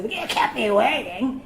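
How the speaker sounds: mock cranky complaint, drawn out; irate patient. Patient arriving and complaining to staff, mock crankiness. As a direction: imitate a patient griping.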